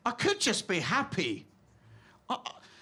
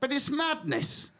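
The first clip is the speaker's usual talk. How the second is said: ordinary talk, nothing marked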